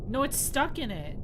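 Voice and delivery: ordinary talk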